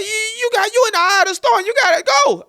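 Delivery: very high, loud mock-frantic warning; hammed-up stammering shriek; panicked caller. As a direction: imitate a friend yelling in panic. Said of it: "you also causing panic".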